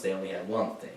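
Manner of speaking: ordinary talk, nothing marked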